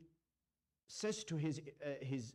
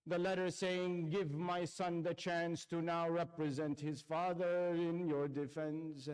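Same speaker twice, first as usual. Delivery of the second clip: impassioned preaching. Acted. Preacher recounting Ashura to mourners, impassioned lament. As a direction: pour grief into every phrase, building. Preacher's rising Karbala recitation.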